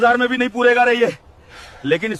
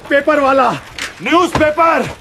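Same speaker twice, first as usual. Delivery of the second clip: street vendor's shout; 6 semitones higher than usual. Acted. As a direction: belt it out to draw buyers. Hawking papers louder to find buyers.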